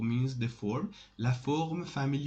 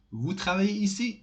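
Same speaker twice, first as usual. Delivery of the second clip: "Vous travaillez ici?" higher and louder, as a question by intonation alone; 7 semitones higher, 5 dB louder than usual. Shown as a demonstration. Teacher showing the intonation question format, questioning intonation. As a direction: ask "Vous travaillez ici?" with the voice raised, no est-ce que. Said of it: "normal way of questioning avec intonation".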